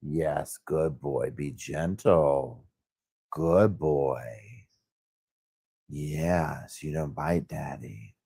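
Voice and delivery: soft, low reassurance to a pet; slow, soothing praise for a dog; gentle dog talk. Real, not acted. Owner settling the dog with praise, gentle and soft.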